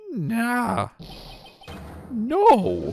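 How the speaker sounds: high, loud flustered no; the judge blurting a refusal; flustered old judge. Acted. Judge fumbling an answer.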